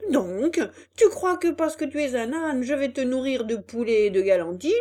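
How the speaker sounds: loud, scornful scolding; angry. Acted. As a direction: sneer it out in anger. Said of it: "de plus en plus en colère".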